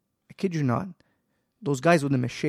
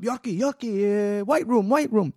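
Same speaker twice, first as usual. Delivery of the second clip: calling backup; 9 semitones higher, 5 dB louder, slower than usual. Retold or imitated.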